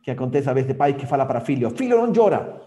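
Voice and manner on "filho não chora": louder, higher scolding father; a father snapping at his crying boy; stern father. Retold or imitated. Father scolding his crying son, loud and stern.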